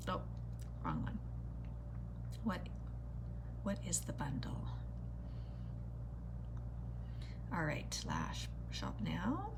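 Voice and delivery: hushed muttering to herself; whispering. Real, not acted. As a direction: whisper while searching the site. Searching website, muttering.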